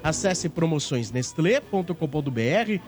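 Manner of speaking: usual speaking voice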